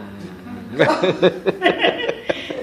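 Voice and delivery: a long loud burst of laughter. Real, not acted. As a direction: let out a big real laugh. Laughs at her own joke.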